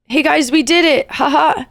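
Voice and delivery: bright-eyed cartoon hero cheer, hammed up; Disney protagonist. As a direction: pretend the naive happy ending. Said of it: "That's the Disney protagonist in me".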